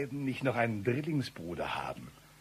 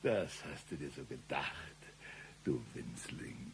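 low, soft threatening hiss; menacing sneer; 8 semitones lower, 10 dB quieter than usual. Acted. Intruder sneers down at the little man.

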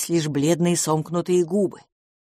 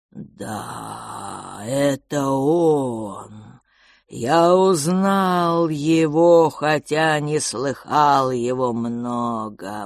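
lower, slow, drawn-out creature voice; slow cold whisper; 5 semitones lower, much slower than usual. Acted. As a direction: stretch each word like resin. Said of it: "Он говорил медленно и тихо, растягивая слова". (медленный холодный шёпот)

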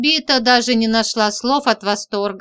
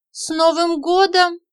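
rising, higher, puzzled; puzzled question; 8 semitones higher than usual. A narrator's reading. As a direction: ask it back, taken aback. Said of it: "Виолетта почему-то смутилась".

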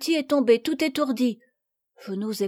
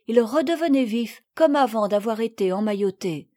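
ordinary delivery

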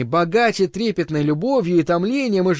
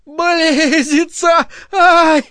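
much higher, louder piercing shriek; 11 semitones higher, 6 dB louder, much slower than usual. Acted. Clara shrieks in frenzy, piercing loud. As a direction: shriek as if being cut. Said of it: "взвизгнула, точно режет".

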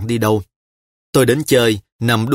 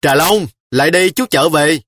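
put-on raised call after a child; 5 semitones higher, 4 dB louder than usual. A narrator's reading. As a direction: call her back, louder. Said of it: "miệng kêu ơi ới".

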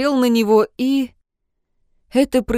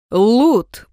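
loud shocked cry; shouting; 2 semitones higher, 8 dB louder than usual. A narrator's reading. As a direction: call the name out loud. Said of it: "повторил Джун".

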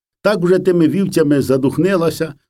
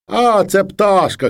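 put-on Dmytro fibbing to child; 7 semitones higher, slower than usual. A narrator's reading. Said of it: "каже Дмитро".

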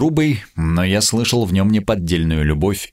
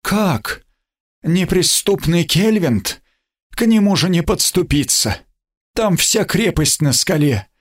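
shocked, much higher disbelief; sputtering foiled villain; 9 semitones higher than usual. Acted. King stunned by fortress's fall. (ошеломлённо, не веря)

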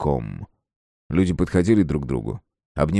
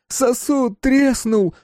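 very high, loud, slow cry; shrieked, stretched-out alarm; alien crowd voice; 4 dB louder, much slower than usual. A narrator's reading. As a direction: wail it as an alarmed monster. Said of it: "чужих и настоящих".

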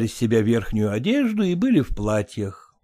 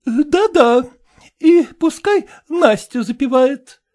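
high, brisk society matron; brassy lady voice; 11 semitones higher, 6 dB louder, slower than usual. A narrator's reading. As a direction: play the bossy choir mistress. Kolkina voiced.

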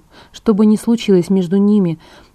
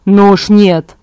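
firm objection; 8 dB louder, much slower than usual. A narrator's reading. Emmett objects to Edward's plan.

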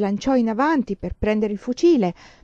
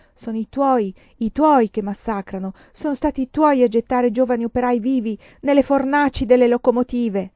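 impassioned accusation, growing louder and higher; accusing; 4 semitones higher, 6 dB louder than usual. A narrator's reading. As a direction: hurl the blame back with rising force. Accusa piangendo il prozio. (accusa accorata in crescendo)

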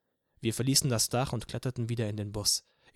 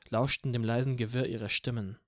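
plain reading voice

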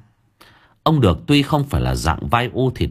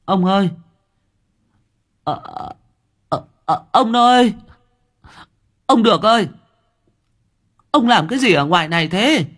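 calling out; 10 semitones higher than usual. Acted. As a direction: call out anxiously for her husband. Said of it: "Bà dọi đèn ra xung quanh rồi gọi".

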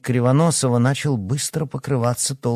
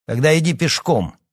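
hard, raised command; loud, higher, unbending tone; harsh; 7 semitones higher, 5 dB louder than usual. A narrator's reading. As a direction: order him out sternly. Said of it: "жёстко сказал Эдуард".

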